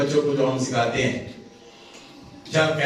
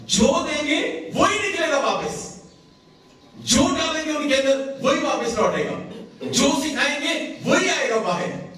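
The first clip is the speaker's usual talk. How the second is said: soaring preacher refrain; 8 semitones higher than usual. Real, not acted. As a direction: lift each line high and press it home. Sermon climax, repeated refrain. (بلند آواز میں واعظانہ ٹیپ کا مصرع)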